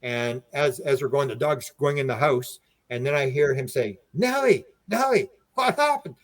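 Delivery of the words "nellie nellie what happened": high, retold cry to the dog; alarmed calling. Owner to his bleeding dog, high alarmed call.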